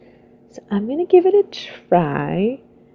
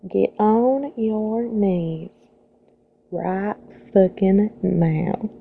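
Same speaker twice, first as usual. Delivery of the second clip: sexy Southern belle. Acted. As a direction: read each item as a playful Southern belle. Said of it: "to do a sexy Southern belle".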